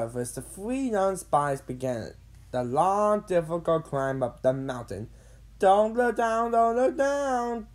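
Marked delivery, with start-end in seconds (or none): 5.6-7.7 high, loud, drawn-out chant; anxious repeating. A narrator's reading. Carl climbing the mountain, anxiously repeating. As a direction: repeat it like a worried mantra, high and slow. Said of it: "Carl repeated over and over".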